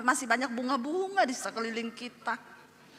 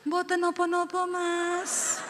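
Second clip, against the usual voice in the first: high, slow, sing-song wifely voice; drawn-out syllables, sweetly deferential; dainty Javanese wife; 6 semitones higher, much slower than usual. Retold or imitated. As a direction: put on an exaggeratedly polite Javanese wife. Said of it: "saya ngomong begitu sampai malam".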